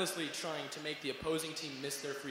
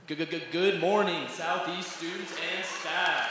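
loud, stretched-out stuttered morning call; booming announcer greeting; 3 semitones higher, 7 dB louder, much slower than usual. Acted. Student announcer greets Southeast students and staff, booming greeting. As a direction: belt out a drawn-out announcement opener.

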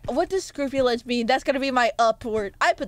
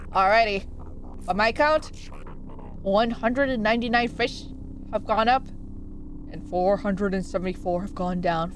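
put-on slightly lowered old-timer voice; old man voice; 4 semitones lower than usual. Old fish giving his count to the player, old man voice. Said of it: "That's an old man voice".

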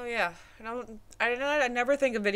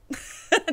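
laughing; 11 semitones higher, 4 dB louder than usual. Real, not acted. Laughs at own joke.

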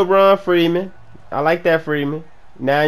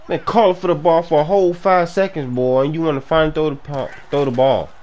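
ordinary talk, nothing marked

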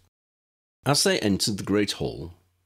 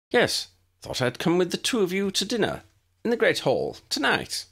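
higher, hurried schoolboy eagerness; scrambling eager prefect; 12 semitones higher than usual. A narrator's reading. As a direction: rush to get the invitation in. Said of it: "he scrambled to say".

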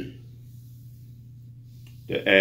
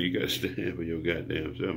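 usual speaking voice

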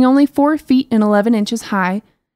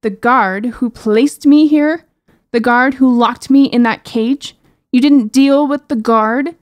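rising anger, louder and slower; calm turning angry; 4 semitones higher, 5 dB louder, slower than usual. Acted. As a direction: build from disbelief to fury. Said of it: "her voice rising in anger".

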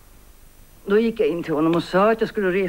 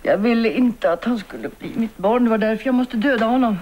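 higher and louder, building to the killing; rising confession; 6 semitones higher, 4 dB louder than usual. Acted. Mother admitting to Selma she had to kill him. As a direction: force the words out in anguish.

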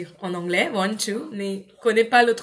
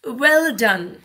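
put-on bright, louder "well done" to a child; encouraging praise; 7 dB louder than usual. Shown as a demonstration. Teacher praising a child's English, louder. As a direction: cheer the child on.

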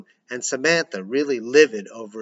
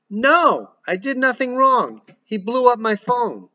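much higher, loud protest; yelling; 11 semitones higher, 6 dB louder than usual. Acted. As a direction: yell it from behind a door. Daughter yelling from room.

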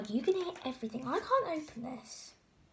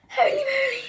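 high, loud "Holy moly"; real shocked cry; startled exclamation; 13 semitones higher, 10 dB louder than usual. Real, not acted. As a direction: yelp it out, jolted by the cold. Reacts to a cold drink.

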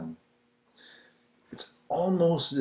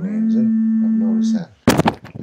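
loud, high joke; 12 semitones higher, 9 dB louder than usual. Real, not acted. Joking remark about R's.

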